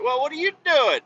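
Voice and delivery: high-pitched catch-up voice; hammed-up friendly caller; acted phone-call question. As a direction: ask it high, like hollering to an old buddy.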